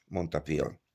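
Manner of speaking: plain reading voice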